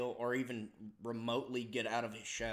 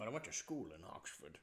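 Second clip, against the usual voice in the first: mock Scottish accent; 3 semitones lower, 9 dB quieter than usual. Guest joins the actor impression.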